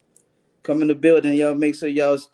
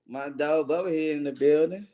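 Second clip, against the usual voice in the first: ordinary delivery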